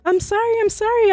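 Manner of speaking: put-on high, pleading little-girl voice; scared apology. Child pleading with the high stepfather, scared. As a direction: beg him, frightened, as a child. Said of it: "And I was like".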